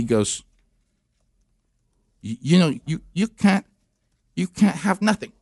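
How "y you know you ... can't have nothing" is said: put-on high, hesitant French-accented English; halting French accent. French son trying country talk, halting French accent. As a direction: stumble out CAN'T like a French learner. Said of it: "he can't do the flow of it".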